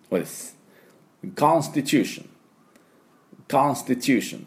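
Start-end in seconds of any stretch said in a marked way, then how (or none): 1.4-4.4 native-style English accent, higher pitch; crisp native English model word; native English pronunciation. Shown as a demonstration. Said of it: "ネイティブノートで言うと".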